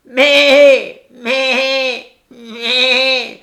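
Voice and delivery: very high, very loud bleat; booming goat bleat. Acted. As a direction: bleat with all your might. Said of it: "il reprit de plus belle et plusieurs fois de suite".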